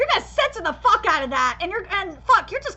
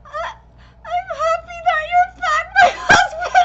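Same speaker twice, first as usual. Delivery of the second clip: tearful wife quote; 9 semitones higher, 5 dB louder than usual. Retold or imitated. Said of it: "and she says".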